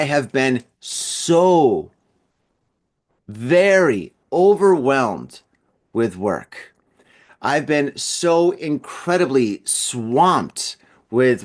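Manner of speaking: "so", "very" and "overwhelmed" stretched slowly, with pauses; drawn-out emphasis. Real, not acted. Host explaining his absence to listeners, dragging out "so" and "very" for emphasis. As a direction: lean long and slow on "so" and "very". Stressing how overwhelmed he has been.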